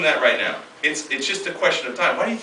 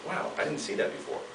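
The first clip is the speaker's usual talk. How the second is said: 1 hushed wonder; 12 dB quieter than usual. Retold or imitated. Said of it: "you go".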